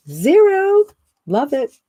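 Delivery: Zero. as a thrilled shriek; squealing zero. Acted. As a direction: let Zero. burst out in delight. Excited about no tech, building.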